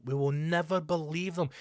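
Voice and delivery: normal talking voice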